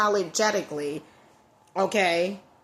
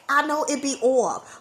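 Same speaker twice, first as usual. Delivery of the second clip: ordinary talk, nothing marked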